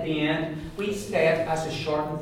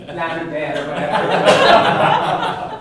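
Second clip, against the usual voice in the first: higher, louder laughing instruction; 6 semitones higher, 12 dB louder than usual. Real, not acted. Teacher laughing while she tells the class to practise. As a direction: laugh through the tip.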